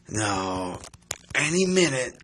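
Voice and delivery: ordinary talk, nothing marked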